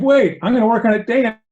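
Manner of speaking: high, loud mock protest; shocked student. Student objecting to his Zen master, acted loud alarm. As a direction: burst in as the alarmed, eager student. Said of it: "Student is shocked by this".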